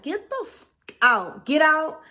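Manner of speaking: high, rising mock yell to get out; angry get out. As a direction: snap at the doctor to get out. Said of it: "I'm just thinking".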